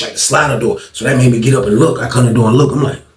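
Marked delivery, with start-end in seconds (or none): none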